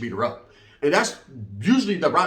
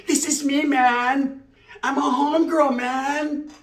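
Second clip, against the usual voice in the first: put-on a higher girl's voice, street swagger; stalker girl voice; 9 semitones higher, much slower than usual. The stalker girl telling him she's one of the homies, in a higher voice. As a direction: play her like a tough homegirl. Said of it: "she was like that Heiner from Colors".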